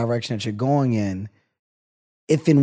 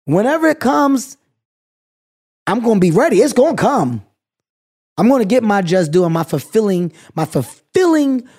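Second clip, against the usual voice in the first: fired-up vow; 5 semitones higher, 4 dB louder than usual. Real, not acted. Vowing he will be ready.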